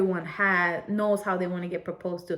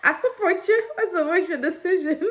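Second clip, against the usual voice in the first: mock supportive; 9 semitones higher than usual. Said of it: "you ain't got a boyfriend".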